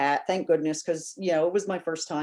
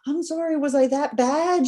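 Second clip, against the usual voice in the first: alarmed apology; 8 semitones higher, 3 dB louder than usual. Retold or imitated. Seeing fan in tears.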